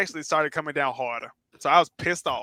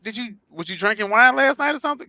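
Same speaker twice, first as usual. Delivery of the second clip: teasing friend; 9 semitones higher than usual. Retold or imitated. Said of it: "So I asked him".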